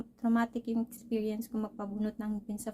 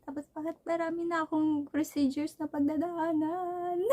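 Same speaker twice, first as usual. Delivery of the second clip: sing-song complaint; 6 semitones higher than usual. Real, not acted. Complains about many procedures.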